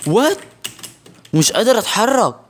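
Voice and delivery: high, loud disbelief; can't believe he's stuck; shocked. Real, not acted. Gamer frozen by a glitch, shocked high cry.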